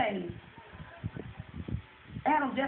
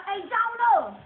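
higher, louder hail to someone passing overhead; calling out; 7 semitones higher, 6 dB louder, much slower than usual. Retold or imitated. Flagging down Jonah riding the whale, calling out. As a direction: flag him down with a shout.

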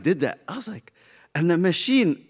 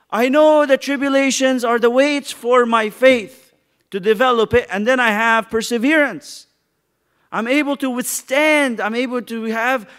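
louder, higher preacher voice driving the point; full-force preaching; 6 semitones higher, 8 dB louder than usual. Acted. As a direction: preach it out to the congregation.

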